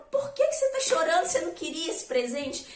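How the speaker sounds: high, quick baffled dad voice; puzzled father. Acted. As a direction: ask like a confused father. Father asks crying son why.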